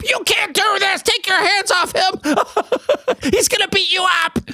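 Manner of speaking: very high-pitched mock shriek with bursts of laughter; hammed-up drunk girlfriend screeching at a cop; screaming drunk girlfriend. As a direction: falsetto caricature of a screaming girlfriend. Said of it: "screaming at the cop".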